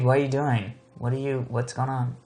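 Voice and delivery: ordinary delivery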